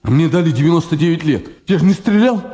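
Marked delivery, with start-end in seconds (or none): 1.7-2.4 desperate. Acted. Desperate protest of innocence.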